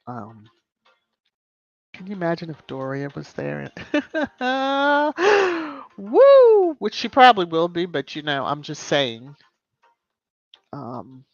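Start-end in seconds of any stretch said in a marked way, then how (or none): 3.7-5.9 high, delighted burst of laughter; real amused laugh; big laugh. Real, not acted. Laughs imagining Doria there. 6.0-6.7 loud, high whoop of delight; real gleeful whoop; excited whoop. Real, not acted. Whoops after laughing.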